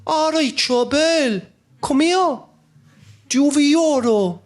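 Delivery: high, slow, drawn-out Cork robber voice; sly old Cork robber greeting his mark, high and slow. Retold or imitated.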